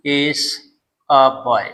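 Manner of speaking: a pause after each word for the class to write; dictated word by word. Shown as a demonstration. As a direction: say "he", "is", "a boy" as separate dictated words. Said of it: "जैसे हम कहेंगे". (रुक-रुक कर बोला गया वाक्य)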